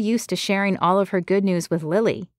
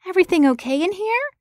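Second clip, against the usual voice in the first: concerned assistant; 12 semitones higher, faster than usual. A narrator's reading. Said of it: "concern in her eyes".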